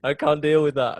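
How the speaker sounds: overwhelmed, can't face it; mock panic. Imagining losing his mum, mock panic. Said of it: "I'd just be like".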